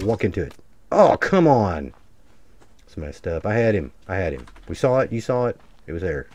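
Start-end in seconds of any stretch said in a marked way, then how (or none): 0.9-1.9 drawn-out frustrated groan; slow exasperated complaint. Real, not acted. Missed shot frustration.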